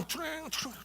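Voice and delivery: high, quiet crashing-plate noises; vocal smash effect; imitated crashing. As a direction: mimic dishes shattering in a small high voice.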